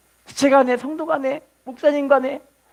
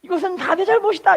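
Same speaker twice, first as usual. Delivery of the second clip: mother-in-law taking blame; 8 semitones higher than usual. Retold or imitated. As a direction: insist it's her fault. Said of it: "이렇게 이야기를 하는 거예요".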